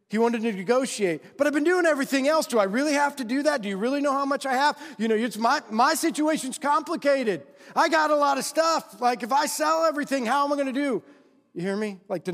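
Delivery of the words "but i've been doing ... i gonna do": higher, fast, anxious pleading; pleading rich man. Retold or imitated. Rich young man begs Jesus for easier terms, high and fast. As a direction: talk your way out of selling everything.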